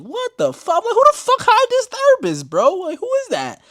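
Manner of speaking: recreated outraged scream, voice cracking high; shocked yell. Retold or imitated. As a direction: shriek at the absurd therapist. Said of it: "And I was like".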